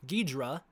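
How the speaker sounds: Ghidra in two syllables, gid-ruh; clipped two-syllable Ghidra; American pronunciation. Shown as a demonstration. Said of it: "that's how Americans usually say it".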